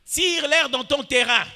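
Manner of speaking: loud, high exhortation; preacher's rallying cry. Real, not acted. Preacher rallying the church to their own ground, rallying cry. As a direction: call it out to the whole church.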